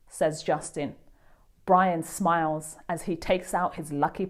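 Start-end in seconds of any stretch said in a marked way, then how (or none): none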